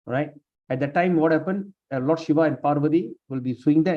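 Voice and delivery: ordinary talk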